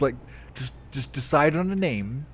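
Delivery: ordinary talk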